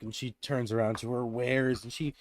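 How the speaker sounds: ordinary delivery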